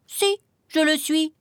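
high-pitched, insistent chick voice; small chick standing up for himself; protesting little chick. A narrator's reading.

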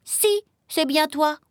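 high, stubborn chick voice; small chick refusing to believe it; insistent little chick. A narrator's reading. Chick insists lizard is father.

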